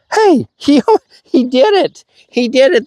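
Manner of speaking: high, bursting with glee; delighted. Real, not acted. Trainer to the dog that just fetched, high delighted praise. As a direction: cheer the dog's first success.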